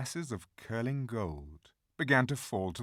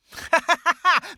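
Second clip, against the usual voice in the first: high, loud witch's cackle; shrill triumphant witch cackle; wild fierce laugh; 13 dB louder than usual. A narrator's reading. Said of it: "gave a wild, fierce laugh".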